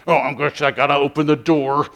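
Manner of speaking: sulky sigh of duty; grumpy guy. Retold or imitated. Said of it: "like a grumpy guy".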